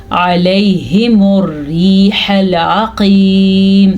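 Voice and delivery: slow recitation, "علیہم" opening on a throaty ayn; throat ayn. Shown as a demonstration. Teacher reciting for learners, "علیہم" slow with true ayn. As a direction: recite slowly, long ī in "العقیم". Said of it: "عین کو آپ نے اپنے مخرج سے".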